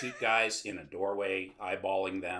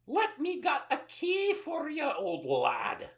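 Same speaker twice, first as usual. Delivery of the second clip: much higher, hammed-up Irish innkeeper; a falsely cheery Irish motel owner handing over a key, pitched way up; over-the-top Irish lilt, much higher; mock Irish accent; 4 dB louder, slower than usual. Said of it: "is terribly Irish".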